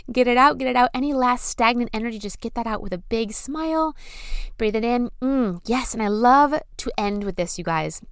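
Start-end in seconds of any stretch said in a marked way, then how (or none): none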